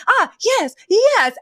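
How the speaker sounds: light up as it finally clicks; sudden recognition. Retold or imitated. Russian woman suddenly understands.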